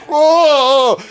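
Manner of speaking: high, drawn-out mock "oh"; exaggerated high-pitched surprise; crowd's surprised realization. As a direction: imitate a crowd suddenly catching on. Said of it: "everybody was like".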